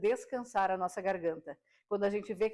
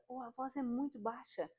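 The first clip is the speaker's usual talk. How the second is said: much softer, barely there; very quiet voice; 3 semitones higher, 9 dB quieter than usual. Shown as a demonstration. Voice coach showing viewers a very quiet voice, much softer. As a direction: drop the volume right down to show a quiet voice. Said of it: "Olha quantos tons de voz diferentes".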